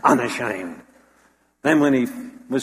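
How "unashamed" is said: low, firm barked word; barked proudly. Retold or imitated. Paul under the lash, barking back: low proud declaration. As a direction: chest out, bark it with pride. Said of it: "bark out the words".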